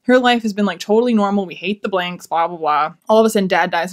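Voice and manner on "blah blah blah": normal talking voice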